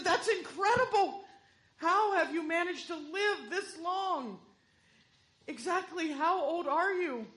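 high, loud astonished preacher; shocked. Acted. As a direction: gasp it out in disbelief. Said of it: "the preacher was in shock".

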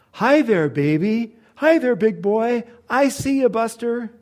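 put-on high, loud sing-song baby talk; a loud old drunk cooing at a baby; drunk man cooing. An old bum calls across the diner to a baby, loud and high.

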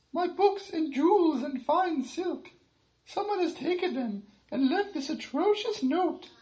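high fluttering royal panic; distressed queen. Acted. Queen continues complaining.

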